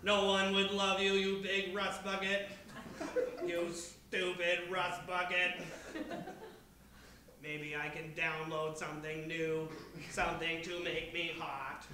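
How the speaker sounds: a hint of a lovesick robot. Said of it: "I am just trying out new voice".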